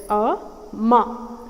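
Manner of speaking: amma split slowly as A, ma; split into syllables. Shown as a demonstration. Teacher splitting the word for learners. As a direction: break amma into its two beats. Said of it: "I'm stressing on the second syllable".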